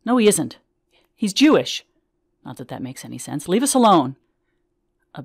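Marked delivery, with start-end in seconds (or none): 0.0-1.8 higher, louder angry protest; wife rebuffing the priest; angry retort. Retold or imitated. Wife shouting at stranger. 3.5-4.2 higher, louder, leave us alone; wife driving the stranger away; angry command. Retold or imitated.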